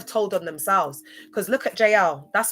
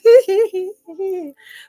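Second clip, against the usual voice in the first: loud, high tittering laugh; high, drawn-out hee-hee laugh; high giggle; 11 semitones higher, 10 dB louder than usual. Real, not acted. Giggles after "them ones.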